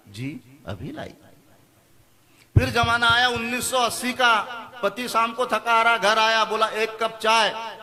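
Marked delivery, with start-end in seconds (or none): 0.1-1.1 soft and slow, much quieter; lovingly. Acted. The 1970s wife answers her husband lovingly and softly. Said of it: "पत्नी बड़े प्यार से बोलती". 6.7-7.8 slow, dragged "एक cup चाय"; weary request. Acted. Tired husband comes home and asks his wife for tea, slowly.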